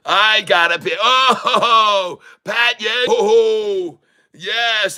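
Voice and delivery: high, elated cries; ecstatic whooping. Real, not acted. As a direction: whoop with real joy. Overjoyed at the chart.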